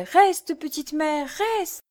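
put-on high child's voice; pleading little boy. A narrator's reading. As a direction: beg as the child. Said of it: "Georges répétait". (enfant qui supplie, voix aiguë)

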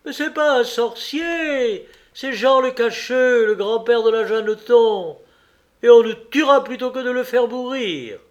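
high, loud outcry of indignant women; protesting women. A narrator's reading. Women taking the condemned man's side, protesting. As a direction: plead loudly, pitched well up. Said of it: "elles se prirent à parler toutes à la fois".

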